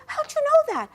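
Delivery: high-pitched startled question; mock-astonished, leaping high; imitated surprise. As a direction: act out a brother caught off guard. Acting out brother's startled question.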